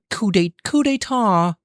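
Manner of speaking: very high imitation of a woman; mimicking woman's voice. Streamer mimicking pronunciation clip, high woman's voice.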